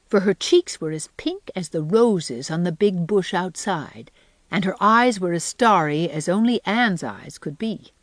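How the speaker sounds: usual speaking voice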